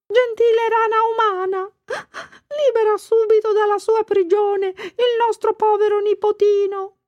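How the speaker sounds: desperate crying. A narrator's reading. Aunt Ply weeping and begging the frog-girl to free her nephew. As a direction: beg through tears, very high. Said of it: "cominciò a piangere disperata".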